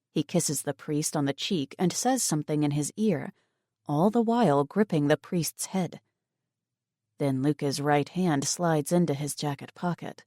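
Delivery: ordinary delivery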